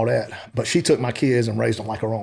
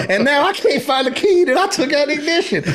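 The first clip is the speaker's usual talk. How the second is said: high, laughing through the words; can't hold back the laughter; 14 semitones higher than usual. Real, not acted. Telling the podcast host the key went missing, laughing.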